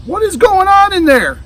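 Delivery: high, loud call from another room; mother calling to check on the noise; loud high call from the next room. Acted. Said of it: "called Zach's mother".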